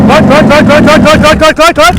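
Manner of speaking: loud, rapid repeated shout. Real, not acted. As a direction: shout cut at the rider. Shouting a stop to the rider.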